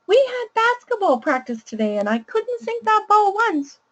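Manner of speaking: put-on high child voice. Shown as a demonstration. Educator reading the child's basketball problem in a high voice. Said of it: "I'll use a really high voice to read the child's problem".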